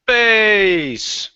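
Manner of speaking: drawn-out, high gleeful yell; long, high-pitched battle cry; triumphant scream. Pretend. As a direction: scream it out, stretching the last word. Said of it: "I scream".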